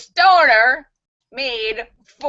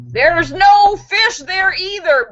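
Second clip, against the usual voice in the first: much higher, loud, slow voice from above; booming voice; 10 semitones higher, 6 dB louder, slower than usual. Acted. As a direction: boom it down like a hidden voice. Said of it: "booms the voice".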